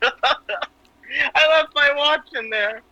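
high, drawn-out words through laughter; real laughter. Real, not acted. Comedian finishing the joke about the doctor's watch, laughing. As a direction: crack up while dragging out the punchline.

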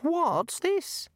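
very high puzzled god voice; high, bewildered little deity; puzzled god, far above the narrator's voice; small god, puzzled. A narrator's reading.

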